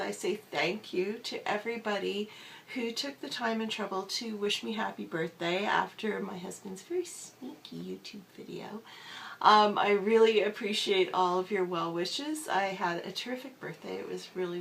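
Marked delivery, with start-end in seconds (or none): 6.4-8.8 whisper. Real, not acted. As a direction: voice giving out into a whisper. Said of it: "excuse the gravelly sound and the whispering".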